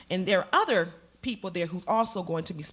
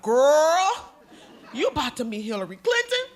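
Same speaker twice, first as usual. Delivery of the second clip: put-on loud, high "girl", then an amazed question; excited disbelief; 5 semitones higher, 8 dB louder than usual. Coworkers to her on the big news, excited disbelief. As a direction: react like a thrilled, astonished friend.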